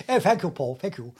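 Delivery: a lightly voiced posh thank-you, first word loud and high; chirpy grateful colleague, hint of prim; hint of a prim surveyor thanking his mate; polite Tim. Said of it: "I'd say, "Here's your hat." He'd".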